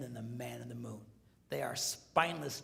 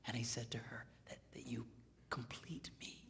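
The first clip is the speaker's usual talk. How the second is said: near whisper, soft; hushed, tender retelling; 3 semitones lower, 11 dB quieter than usual. A narrator's reading. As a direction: lean in and whisper it. Tender movie moment retold.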